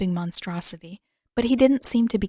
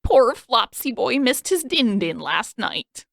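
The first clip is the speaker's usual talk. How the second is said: doting eccentric king; 4 semitones higher, 6 dB louder, slower than usual. A narrator's reading. Eccentric king coos at pet.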